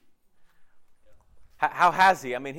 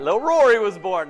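excited announcement; 4 semitones higher, 11 dB louder than usual. Real, not acted. Announcing church family's baby.

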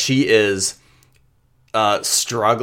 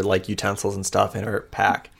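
usual speaking voice

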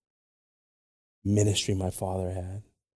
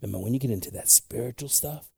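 ordinary delivery

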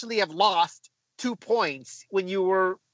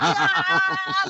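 high celebratory scream; high-pitched open yell of joy; retold scream; 13 semitones higher than usual. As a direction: re-enact a wild goal scream. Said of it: "I screamed".

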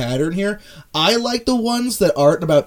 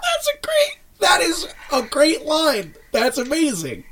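mock crying; 10 semitones higher, 3 dB quieter, slower than usual. Moved by romantic line.

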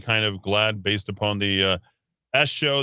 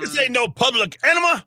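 much higher, put-on voice quoting a movie; quoting a movie line; 3 dB louder than usual. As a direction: screech it like the party movie guy. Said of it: "to quote the guy that screamed that".

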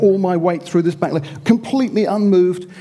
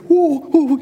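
mock cold-water gasps, a bit higher; gingerly ooh; 7 semitones higher, 3 dB louder than usual. Swimmers easing into cold sea, mock timid oohs. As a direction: squeal lightly at cold water. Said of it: "very gingerly sort of tend to".

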